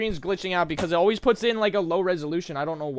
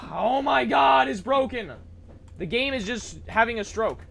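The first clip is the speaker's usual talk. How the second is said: raging gamer; 6 semitones higher than usual. Real, not acted. Game broken, raging.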